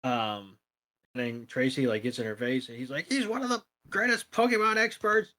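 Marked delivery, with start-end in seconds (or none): 3.1-5.3 gushing fanboy. Retold or imitated. Retelling Tracy gushing over Professor Oak.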